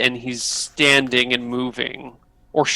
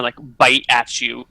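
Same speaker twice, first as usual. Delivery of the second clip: ordinary talk, nothing marked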